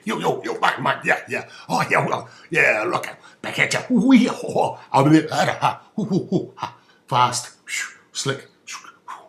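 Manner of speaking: loud high fast hype chant; eighties hype talk. Retold or imitated. Comic voicing eighties high-flyers, in a loud hype chant. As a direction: pump it like a coked-up broker. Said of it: "the New Zealand language became very".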